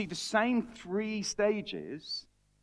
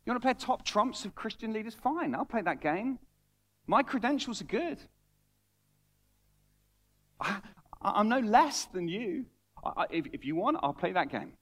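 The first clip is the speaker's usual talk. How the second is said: defiant Paul; 5 semitones higher than usual. Acted. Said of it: "He's saying".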